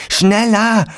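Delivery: high, loud urging cry; urgent gasped cry. A narrator's reading. Felix to friends stamping out flames, urgent high cry. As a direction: urge them on at the top of your voice. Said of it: "keuchte Felix".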